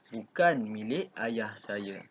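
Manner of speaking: slow, deliberate reading of bukan milik ayah saya; slow model reading. Shown as a demonstration. Teacher repeats the example slowly for pupils to hear intonation. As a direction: spell out the tune word by word. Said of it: "sambil tu dengar intonasi".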